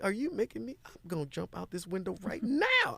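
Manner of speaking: hammed-up despair at the mentor; exaggerated plea from his younger self; despairing past self. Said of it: "I was like".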